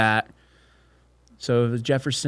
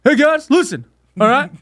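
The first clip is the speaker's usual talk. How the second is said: high, loud hammed-up announcement; PC Principal; 13 semitones higher, 4 dB louder than usual. Speaker addressing the guys about a strong woman, hammed-up impression. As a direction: call for attention in a pushed-up put-on voice. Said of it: "Look like P C principal".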